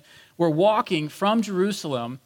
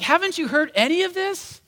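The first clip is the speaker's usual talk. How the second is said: incredulous; 11 semitones higher than usual. Retold or imitated. Disciples amazed stranger hasn't heard.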